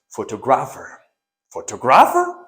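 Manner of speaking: "photographer" built as photo plus grapher; wrong pronunciation. Shown as a demonstration. Teacher testing students with the error, "photographer" as photo-grapher, then questioned. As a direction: say "photographer" as FOH-toh-GRAF-er. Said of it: "it is not pronounced".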